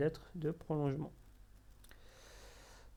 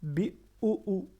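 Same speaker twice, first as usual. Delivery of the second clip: BI OU OU, slow and higher, middle OU retained; held-back shadda; 5 semitones higher, 3 dB louder, slower than usual. Shown as a demonstration. Teacher shows learners shadda, middle OU pressed and held back. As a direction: press the middle OU in BI OU OU, don't release it. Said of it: "Je le retiens".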